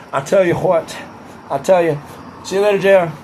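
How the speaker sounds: brief put-on politician's voice; Donald Trump impression. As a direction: slip lightly into a presidential parody. Slips back into Trump voice.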